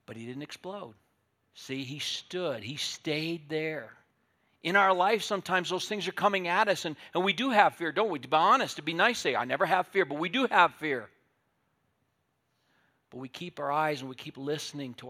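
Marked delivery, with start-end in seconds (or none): 1.5-4.0 slow, deliberate words; slow emphasis. Real, not acted. Preacher slowly stressing that the horse stood. 4.6-11.1 loud, higher preaching push; preaching loudly. Real, not acted. Preacher loudly telling the congregation we all have fear. Preacher rising to full force.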